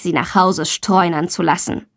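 ordinary delivery

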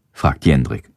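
usual speaking voice

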